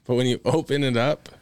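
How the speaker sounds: usual speaking voice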